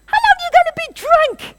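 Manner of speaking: very high, loud scolding yell; mock shout. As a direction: yell at her as a rude priest would. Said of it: "shouts at her".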